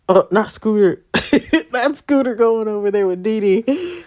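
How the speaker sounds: normal talking voice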